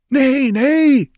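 very high, loud protest; eager cry. A narrator's reading. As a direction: cry out eagerly to placate him. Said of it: "they cried eagerly".

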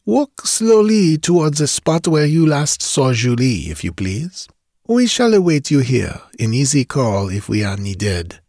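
put-on lightly accented Frenchman; a hint of a French gentleman; French detective voice. De Grandin instructs.

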